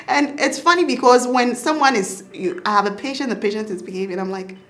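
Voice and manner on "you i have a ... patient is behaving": normal talking voice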